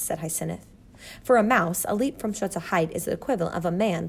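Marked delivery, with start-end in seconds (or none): none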